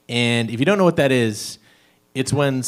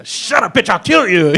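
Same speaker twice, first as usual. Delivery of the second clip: raised, put-on menacing voice; pretend criminal; 6 semitones higher than usual. Boyfriend getting into character as criminal, mock threatening. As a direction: threaten like a play-acted kidnapper. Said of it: "get into character".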